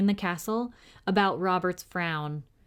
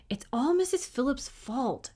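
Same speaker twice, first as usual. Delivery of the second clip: inner thought; 7 semitones higher than usual. A narrator's reading. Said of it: "he thought".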